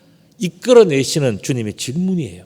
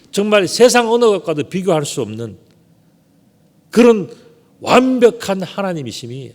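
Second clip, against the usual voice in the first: rising and louder to the peak on 그런; building preaching climax; 5 semitones higher, 4 dB louder than usual. Acted. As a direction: swell up to proclaim God's perfection.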